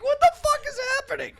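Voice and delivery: very high, quick, laughing disbelief; retold falsetto bafflement; mock bewildered outburst. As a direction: squeal it in comic panic. Retold bewildered reaction to the mishap.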